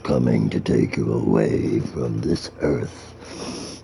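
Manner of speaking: very low, slow demonic voice. Acted. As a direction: rumble it like the devil's spawn. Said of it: "you hear a guy's voice like".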